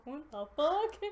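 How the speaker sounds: normal talking voice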